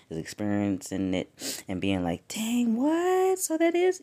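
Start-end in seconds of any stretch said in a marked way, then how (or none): 2.3-4.0 high, squeaky baffled "what?"; confused surprise. Retold or imitated. Listener puzzled by spiritual changes, high surprised squeak. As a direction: pitch it way up, caught off guard.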